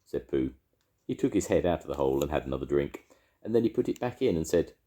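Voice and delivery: ordinary delivery, nothing marked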